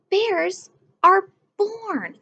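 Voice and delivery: normal talking voice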